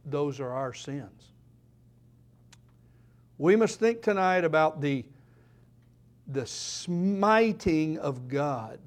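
ordinary talk, nothing marked

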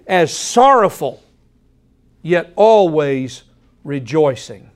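slow, deliberate stress on "always"; slow emphatic repeat. Shown as a demonstration. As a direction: let "sorrowful" fall, then lift "always". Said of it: "That's the way we live".

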